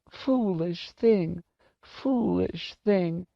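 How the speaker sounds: put-on high, very slow old woman; old lady lost in grief; stunned lament. A narrator's reading. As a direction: repeat it in drawn-out, high dismay. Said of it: "was all Missus Griffin seemed able to say".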